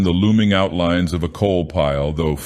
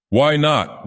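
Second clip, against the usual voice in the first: a sharp, raised retort jumping high; harsh rasp; 9 semitones higher than usual. Acted. Gang boss rasps at his scared henchman, harshly. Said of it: "rasped Rigetti harshly".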